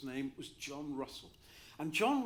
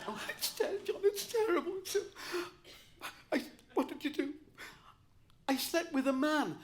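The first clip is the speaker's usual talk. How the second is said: much higher, laughing through a fake sob; laughing mock despair; 11 semitones higher, 6 dB louder than usual. Telling his professor his secret, high laughing mock sob. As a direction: ham up the anguish while cracking up.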